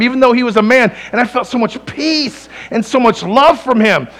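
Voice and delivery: ordinary talk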